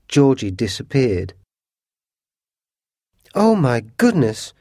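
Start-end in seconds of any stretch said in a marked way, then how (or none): 3.3-4.5 high-pitched, shocked exclamation; alarmed cry. A narrator's reading. Mother finds Georgie has disappeared, crying out. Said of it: "cried Missus Otis".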